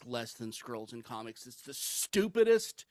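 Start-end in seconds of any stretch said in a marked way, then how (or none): none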